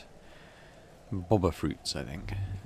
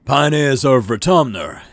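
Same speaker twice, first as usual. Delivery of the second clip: higher, louder and slow, booming; pompous governor; 6 semitones higher, 12 dB louder, slower than usual. Acted. Governor Lum addresses the colony square with a booming pompous speech. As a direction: orate grandly to the assembled colonists. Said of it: "he begins".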